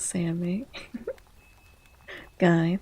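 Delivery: usual speaking voice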